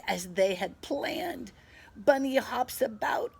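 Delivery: lively storybook reading; animated picture-book voice; reading to children. A narrator's reading. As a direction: read it brightly to a little one.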